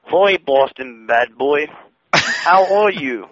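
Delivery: put-on very slow, drawn-out accented interviewer; Donna. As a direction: stretch each greeting in a mock accented drawl. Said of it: "I'm gonna do an impression now".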